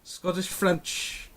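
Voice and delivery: slow, drawn-out mock Scottish-French accent; playful Scottish-French blend. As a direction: mash Scottish and French accents together, very slowly. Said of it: "Scottish with French".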